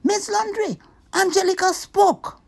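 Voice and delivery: loud, high tattletale call; tattling shout. Acted. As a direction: shout it out to tell on her. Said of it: "heard her and shouted".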